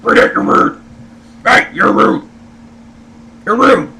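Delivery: loud put-on scolding voice; hammed-up loud telling-off; mock reprimand. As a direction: imitate someone telling you off, over and over. I get reprimanded all the time.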